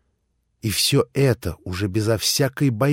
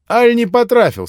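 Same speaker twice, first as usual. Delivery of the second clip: much higher, louder, piqued question; touchy, offended; 13 semitones higher, 9 dB louder than usual. A narrator's reading. Rakitin, suddenly stung, asks Grushenka loud and high. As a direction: ask it already half offended. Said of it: "мигом почти обидевшись".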